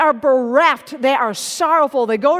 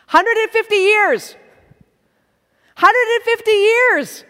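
incredulous emphasis; 6 semitones higher, 3 dB louder than usual. Real, not acted. Preacher stressing huge debt.

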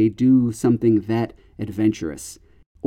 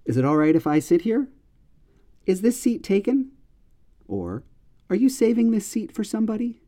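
polite question examples; 9 semitones higher, faster than usual. Shown as a demonstration. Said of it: "You say one of those three things".

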